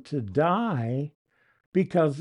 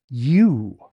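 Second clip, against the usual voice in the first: "You" punched out loud; emphatic you; 6 dB louder than usual. Shown as a demonstration. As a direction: hit "You" hard and loud to single out the believers. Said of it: "is very emphatic in this".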